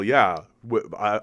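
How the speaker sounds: ordinary talk, nothing marked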